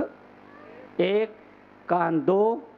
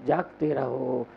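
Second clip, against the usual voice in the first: fading watchman's call; 6 semitones lower, slower than usual. Acted. Saint warning against carelessness, slow sinking watchman's call. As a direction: let each call sink and fade.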